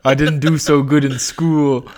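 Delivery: put-on loud New York accent, slow, chanting the team name; a hammed-up Jets fan at a game; New York Joe. New York Joe cheering his team for Chris, in a loud, slow New York accent. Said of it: "caricature of a New York accent".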